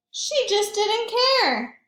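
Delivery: high, loud, slow reveal; excited. A narrator's reading. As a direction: land it with big delight. Story climax, high loud slow.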